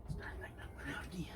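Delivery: quick whispered grumble under the breath. Real, not acted. Grumbling to himself as his pipe goes out, whispered. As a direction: hiss it to yourself, fast and breathless.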